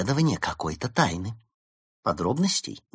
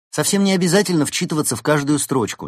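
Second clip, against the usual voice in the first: higher, louder irritated grumble; displeased grumble; 7 semitones higher, 5 dB louder than usual. A narrator's reading. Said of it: "недовольно проворчала Берта".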